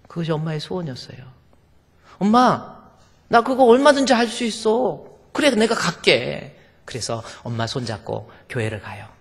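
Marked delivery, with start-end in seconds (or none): 2.2-6.6 high, loud eager reply; eager promise. Acted. Daughter promising her mother, eager and loud. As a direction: daughter bursting to say yes.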